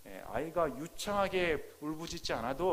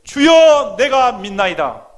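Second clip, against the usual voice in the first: loud, high, pleading shout; a father's desperate cry of faith; crying out to God; 7 semitones higher, 22 dB louder, slower than usual. Acted. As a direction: cry it out loudly from the pulpit. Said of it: "부르짖고 눈물을 흘리며 이르되".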